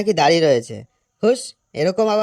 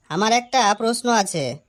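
normal talking voice

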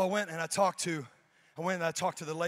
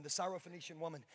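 normal talking voice

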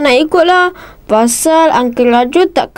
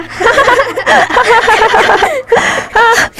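high giggle; 10 semitones higher than usual. Acted. Adil laughs at own joke.

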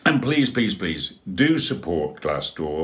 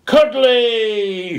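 high, loud joyful shout; Dudley shouting with delight; 10 dB louder than usual. Acted. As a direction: burst out with it. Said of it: "shouted Dudley".

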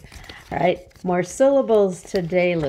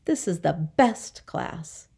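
usual speaking voice

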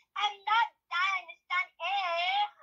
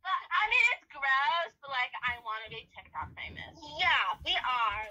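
ordinary talk, nothing marked

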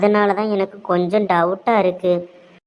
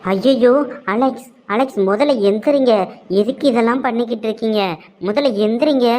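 higher-pitched, dismayed woman's voice; flustered alarm; 4 semitones higher than usual. Acted. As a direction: urge him up, embarrassed and alarmed. Flustered as he falls at feet.

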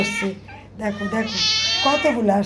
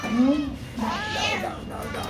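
coaxing whisper; 2 semitones higher, 6 dB quieter than usual. Real, not acted. Coaxing a cat close while feeding it, soft whisper. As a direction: coax the cat gently, barely voiced.

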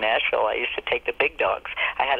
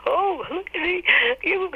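high, wailing mock sobbing; a friend bawling on the phone; imitating sobbing friend. Friend calling in tears, high mock wail. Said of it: "she's bawling her brains out".

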